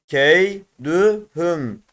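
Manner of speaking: Keydühüm very slowly as key, dü, hüm; drawn-out Keydühüm with gaps between syllables. Shown as a demonstration. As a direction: separate each syllable of Keydühüm. Teacher reads word by syllables.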